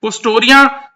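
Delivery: ordinary delivery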